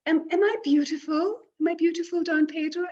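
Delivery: high, quick and hopeful; eager young Martina. Acted. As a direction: ask anxiously for approval. Martina asks shyly.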